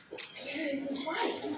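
mock the nurses' hushed disapproval; questioning nurses. Nurses ask about the absent wife, soft and scandalized. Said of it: "even the nurses were like".

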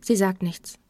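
ordinary delivery

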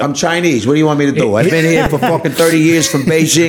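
put-on comic New York Italian accent. Chinese New Yorker insisting he is from Beijing, Italian-American accent. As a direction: protest like an Italian-American wiseguy. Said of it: "you turn Italian".

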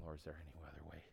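soft, hushed imitation of a sorrowful prayer; low, subdued plea of Jesus; understated weeping plea. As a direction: plead quietly, grieving, without actual sobs. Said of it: "he's weeping and saying".

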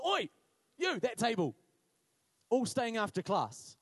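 loud, slowing schoolteacher telling-off; imitated teacher's barked order; stern teacher. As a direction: call out the whole table loudly. Imitates teacher punishing a table.

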